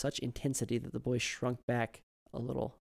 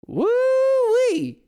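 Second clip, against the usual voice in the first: very high, loud joyful holler; delighted whoop; 14 dB louder than usual. A narrator's reading. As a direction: whoop with a big smile. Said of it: "hear the smile in the man's voice".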